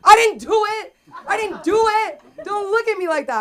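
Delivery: very high, loud yelped denial; mock defensive protest. Comic protests innocence to a staring audience, defensive. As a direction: yelp it like you are wrongly blamed.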